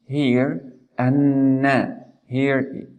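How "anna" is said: slow "anna" with a long nasal n; stressed nun. Shown as a demonstration. Teacher recapping from the beginning, "anna" with held n. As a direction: stretch and hum the double n of "anna". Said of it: "with stress or emphasizing".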